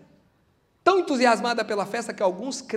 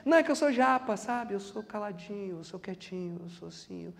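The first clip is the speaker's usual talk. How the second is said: much softer and lower, sinking away; mock shy churchgoer; 3 semitones lower, 8 dB quieter than usual. Preacher mocking the shy believer at church, fading softly. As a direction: shrink into a timid mumble. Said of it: "a gente vê na igreja".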